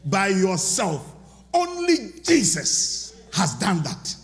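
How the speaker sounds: slow, weighty, each phrase landed; slow emphatic preaching. Acted. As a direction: lay it down word by word. Emphatic teaching on resurrection.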